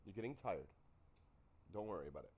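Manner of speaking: lightly voiced soft reassurance to the niece; gentle reassurance. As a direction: soothe her quietly, no fuss.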